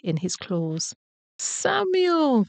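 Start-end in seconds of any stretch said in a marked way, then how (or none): 1.4-2.5 high, drawn-out falling call; joyful cry. A narrator's reading. Mother rabbit sees her lost son, crying out. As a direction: cry the name out high and long. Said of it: "cried Missus Rabbit".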